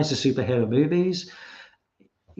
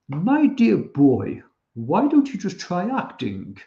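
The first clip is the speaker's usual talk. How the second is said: slow, drawn-out posh English, swooping high; very posh English accent; 4 semitones higher, slower than usual. Retold or imitated. Laurence Olivier to young Dustin Hoffman, very posh English accent. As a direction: quote it in grandly posh English. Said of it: "very, very posh English accent".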